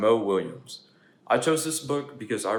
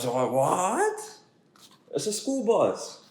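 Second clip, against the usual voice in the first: higher, delighted slowed realization; light up at the bus; excited pigeon; 7 semitones higher, 5 dB louder, much slower than usual. Acted. Pigeon sees the school bus, higher and thrilled.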